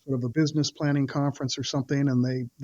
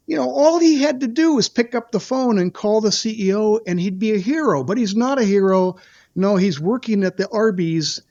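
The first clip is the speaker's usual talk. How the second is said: higher and louder, hamming up a speaker's joke at a victim; mocking showman punchline; 5 semitones higher, 5 dB louder than usual. Witness imitating Lanterman mocking the CFO to the audience, loud hammed-up showman. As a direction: play it like a showman milking a crowd for laughs.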